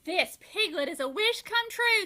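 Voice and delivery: wife overjoyed; delighted. A narrator's reading. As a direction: marvel at it.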